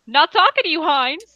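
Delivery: loud, high snap; shouting. Acted. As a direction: bark it back at him. Snapping at interrupting player.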